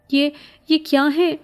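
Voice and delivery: high, stammering frightened cry. A narrator's reading. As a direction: recoil from crawling shadows, crying out. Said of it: "میں نے بلند چیخ ماری". (خوف سے اونچی چیخ نما آواز)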